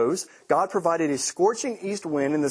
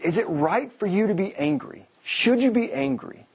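higher, rising gentle challenge; voicing God's question; 8 semitones higher than usual. God asking Jonah if his anger is right, higher and probing. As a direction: ask it like a patient father. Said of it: "so here he's like".

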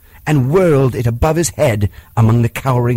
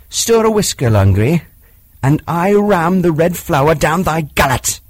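mock the cowering tiger angrily; rising fury; 7 semitones higher than usual. Mowgli beats Shere Khan.

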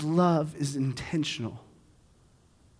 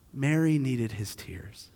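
softer, lower and slow; tender; 5 semitones lower, 7 dB quieter, slower than usual. Acted. Pastor contrasting the sisters, tenderly. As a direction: say it gently.

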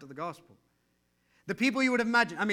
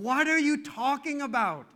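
higher, louder baffled question; exasperated Jesus; 8 semitones higher, 6 dB louder than usual. Retold or imitated. Jesus baffled at his disciples, louder and higher. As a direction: throw up your hands in disbelief. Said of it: "it's like Jesus going".

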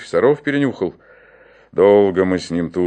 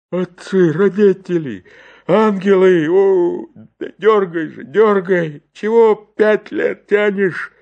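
high, loud pleading in pain; pained yelling deacon; 8 semitones higher, 7 dB louder than usual. Acted. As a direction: yell through the pain of the pull.

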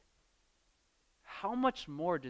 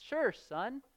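breezy dad, pitched higher and louder; absurdly cheery father handing over a snake; mock cheerful dad; 7 semitones higher, 6 dB louder than usual. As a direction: toss it off like a careless father. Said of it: "And the dad says".

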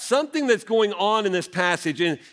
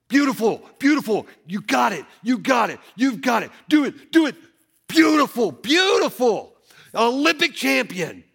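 higher, louder, excited cheering shouts; coach cheering; 6 semitones higher, 4 dB louder than usual. Retold or imitated. Coach cheering on his gymnast mid-routine, excited shouting. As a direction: whoop her on like a coach courtside. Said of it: "you're watching him go".

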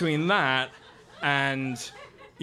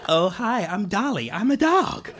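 perky dog, fake American, pitch climbing; Dolly; 2 semitones higher, 3 dB louder than usual. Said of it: "sort of bad American accent".

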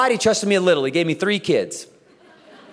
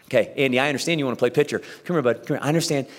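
put-on softer, quick, patient coaxing of a stubborn kid; calm patient coaxing; 6 semitones lower, 4 dB quieter, faster than usual. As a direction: talk the kid down gently, hurriedly. Patient coach calming a kid.

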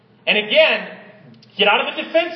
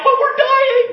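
high, loud mock panic; panicked disciples; 8 dB louder than usual. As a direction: squeal it like scared fishermen. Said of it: "they're like".

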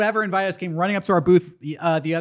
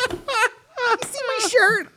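squeaky mock indignation, pitched way up; high mock voice; 4 dB quieter than usual. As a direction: play it as a whiny high-voiced kid. Retelling a bouncer confrontation.

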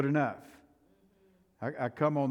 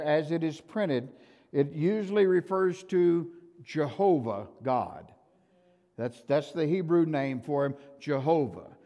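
ordinary talk, nothing marked

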